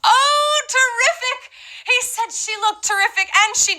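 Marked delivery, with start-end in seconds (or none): 0.0-1.5 high, bright burst of delight; thrilled high echo of the clip; excited echo. Real, not acted. Host echoes the clip excitedly. 2.9-3.3 terrific! sung out high and thrilled; terrific! as a high excited exclamation; excited repeat. Real, not acted. Host repeats clip word.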